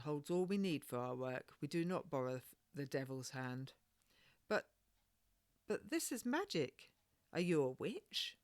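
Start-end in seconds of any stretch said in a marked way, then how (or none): 4.5-8.4 put-on high, curious little girl; a wide-eyed child asking; lightly voiced girl full of wonder; young girl voice. Young girl Maggie asks the midwife.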